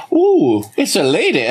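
high, swooping ooh into a mock-flirty line; crooned high, then dropping back; Tim Meadows impression. Southern belle quoting a comedian to her co-host, high playful impression. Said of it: "as Tim Meadows would say".

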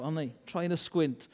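normal talking voice

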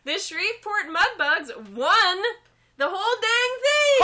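high and loud reveal; excited announcement. Real, not acted. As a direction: announce it like big news. Announcing championship win.

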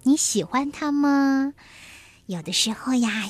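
plain reading voice

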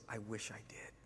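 hushed, breathy whisper, barely voiced; whispered confession. Man admits to preacher he doesn't know God, whispering. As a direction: let it slip out as a sad whisper.

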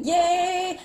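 high, bright yay; excited cheer. Real, not acted. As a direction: cheer it out, thrilled. Celebrating the activated signal.

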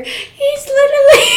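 high, loud laughing cry; laughing shriek. Real, not acted. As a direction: crack up mid sentence. Laughing fit.

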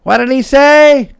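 put-on high, loud old lady asking; a deaf old woman calling out, high-pitched; elderly wife shouting her question; hard-of-hearing wife. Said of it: "And the wife goes".

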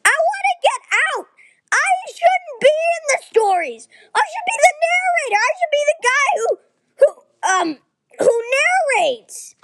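high, fast, frustrated rant; angry. Acted. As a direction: blurt it out in rising frustration. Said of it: "I think Hunter is angry".

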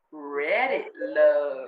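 slow announcer reveal on Reddit Love. Acted. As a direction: announce the segment. Segment announcement.

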